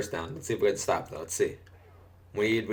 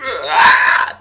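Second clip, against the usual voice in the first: sudden loud hiccup shout; exaggerated hiccup; 7 semitones higher, 13 dB louder than usual. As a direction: burst the hiccup out mid-sentence. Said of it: "exaggerate it like fucking crazy".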